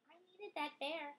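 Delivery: plain reading voice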